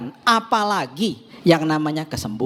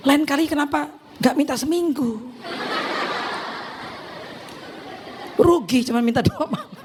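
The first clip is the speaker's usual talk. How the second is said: laughing; 9 semitones higher than usual. Retold or imitated. Husband teasing that she should have asked God for a week, laughing. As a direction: joke said through laughter.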